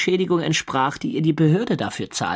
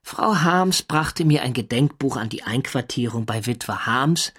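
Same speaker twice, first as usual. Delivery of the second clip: ordinary delivery, nothing marked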